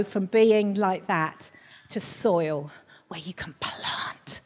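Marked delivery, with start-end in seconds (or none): 3.1-4.4 soft hushed whisper. Real, not acted. Preacher promising congregation good soil for planting, hushed whisper. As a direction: drop to a whisper for emphasis.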